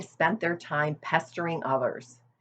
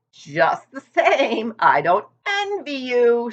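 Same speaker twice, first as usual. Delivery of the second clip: put-on higher, louder old ewe; a gossipy old sheep leaning in; 8 semitones higher, 6 dB louder, slower than usual. A narrator's reading. Old sheep teasing Wilbur about his weight, higher and louder.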